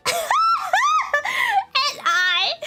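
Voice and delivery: laughing loudly through the words; real laugh breaking out high; burst of laughter. Real, not acted. Laughing at the awkward memory.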